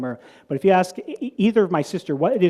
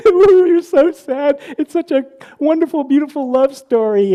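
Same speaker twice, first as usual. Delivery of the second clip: mimicking crying sisters; 10 semitones higher, 7 dB louder than usual. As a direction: ham up the sisters sobbing over the film. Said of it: "and they're just crying".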